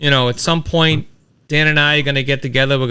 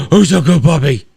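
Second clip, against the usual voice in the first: talking to a dog; 2 semitones higher, 4 dB louder than usual. Real, not acted. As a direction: gush over the puppy.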